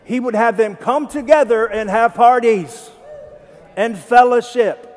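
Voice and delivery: pushing the congregation for an amen; emphatic. Real, not acted. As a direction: shout it out to the crowd. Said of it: "No amen on that?".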